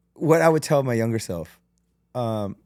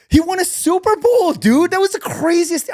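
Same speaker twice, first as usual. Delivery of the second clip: loud, much higher-pitched exclaiming; real excitement; 8 dB louder than usual. Real, not acted. Fan recalling Tom Brady's Super Bowl comeback, loud excitement. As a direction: burst out in loud, high amazement.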